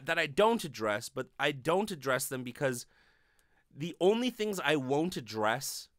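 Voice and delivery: usual speaking voice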